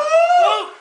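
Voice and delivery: sharp excited whoop; high whoop. Real, not acted. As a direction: let out a party whoop. Hype whoop. (grito de empolgação)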